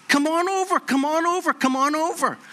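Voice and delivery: mild mock yell, pitched high; retold yelling. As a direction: imitate calling someone over from afar, without full volume. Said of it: "And she's yelling".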